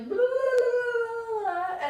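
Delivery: high, loud hammed-up wailing; mock crying rant. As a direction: parody your own sobbing rant. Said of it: "And I literally".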